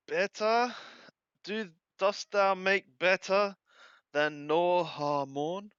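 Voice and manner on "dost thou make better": ordinary delivery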